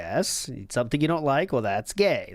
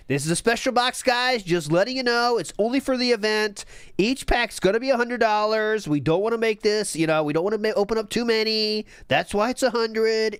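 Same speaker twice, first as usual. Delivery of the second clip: higher, pushier salesman voice for the pitch; imitating a hype streamer; 6 semitones higher than usual. Co-host mimicking the streamer's box pitch, higher and salesy. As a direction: sell the hundred-dollar packs like a showman.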